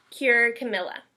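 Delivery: ordinary delivery, nothing marked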